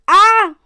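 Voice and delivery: high, loud "aah" of terror; scream. A narrator's reading. Crew scream together as shuttle crashes, screaming. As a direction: scream out in alarm. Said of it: "schrien Paluten, Evil und Edgar gleichzeitig".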